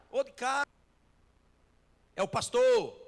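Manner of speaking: calling out. Retold or imitated. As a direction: shout to be heard through the door. Pastor calls out at the door.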